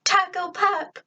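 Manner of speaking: high, delighted greeting; happy cry. A narrator's reading. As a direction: call the nickname out with joy. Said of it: "I cried and returned the hug".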